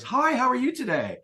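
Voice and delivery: a mock sing-song hello; mock chirpy greeting. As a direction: parody the standard cheery opener. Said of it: "You'll never hear it".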